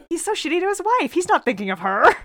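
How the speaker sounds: high, rushed, mild indignation; indignant retort. Retold or imitated. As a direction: protest on the wife's behalf, quickly. Acts out indignation at the professor.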